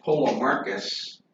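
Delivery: Polemarchus said as po-luh-MAR-kus; pronunciation demo. Shown as a demonstration. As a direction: model Polemarchus carefully, stressing MAR. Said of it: "whose name is pronounced".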